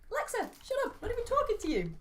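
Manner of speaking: high, exasperated retort; exasperated snap at Alexa. Retold or imitated. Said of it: "I'm like".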